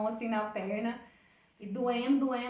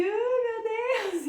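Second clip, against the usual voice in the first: lamento choroso, agudo e arrastado; choro imitado; 11 semitones higher, 4 dB louder, much slower than usual. Retold or imitated. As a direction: imitate a high, drawn-out tearful wail. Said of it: "E aí eu chorando, chorando, assim". (imitando choro, agudo e arrastado)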